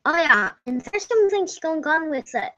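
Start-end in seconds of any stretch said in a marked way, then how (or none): none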